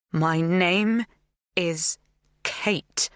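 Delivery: "My name is Kate" with every word stressed and spaced; each word stressed. A narrator's reading. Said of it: "I emphasize each word".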